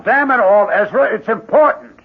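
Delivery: impatient, loud and high; exasperated. Acted. Angry neighbor at the door.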